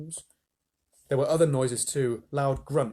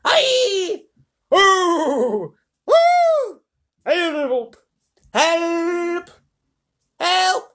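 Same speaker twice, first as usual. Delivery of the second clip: drawn-out high screams and yells for help; ghastly screams; 10 dB louder, much slower than usual. A narrator's reading. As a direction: shriek for help at full pitch. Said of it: "ghastly screams and yells".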